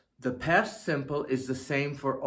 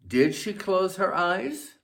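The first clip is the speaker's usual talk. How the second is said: higher question tune, rising at eyes; 6 semitones higher than usual. Shown as a demonstration. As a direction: ask it, voice going up.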